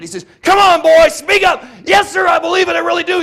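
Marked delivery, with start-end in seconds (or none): 0.4-1.6 put-on old man shouting at the boy to answer. 1.8-3.2 yelled, much higher and louder; boy shouting back to the old man; shouted conviction. Retold or imitated. Boy shouts his belief.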